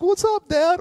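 put-on high, loud, breezy little boy; a cheerful kid who has no idea anyone worried; carefree kid. Son biking back to his frantic dad, high and carefree. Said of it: "He's like".

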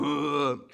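loud, long monster grunt; wordless monster moan; monster groan. Acted. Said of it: "the monster goes".

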